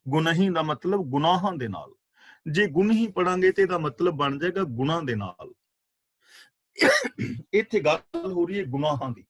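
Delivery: ordinary delivery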